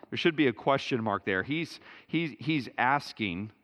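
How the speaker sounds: ordinary delivery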